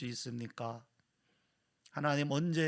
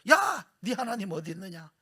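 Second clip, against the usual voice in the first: mocking taunt; 6 semitones higher, 7 dB louder than usual. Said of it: "그냥 기다렸다는 듯이 와가지고".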